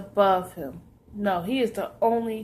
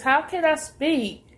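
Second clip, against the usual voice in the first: voicing the Lord; 6 semitones higher, 4 dB louder than usual. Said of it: "And he says".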